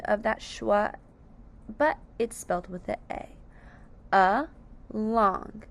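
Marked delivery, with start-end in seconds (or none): 4.1-5.6 "A, long" as two separate syllables, A said uh; split into syllables. Shown as a demonstration. Teacher showing along has two syllables, split into syllables. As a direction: pause between A and long. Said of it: "two syllables".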